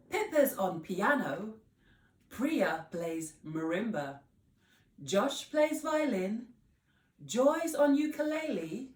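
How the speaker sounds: bright, high, loud singer greeting the newcomer; cheery Miss Potts. Acted. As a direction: welcome her like a showy bandleader.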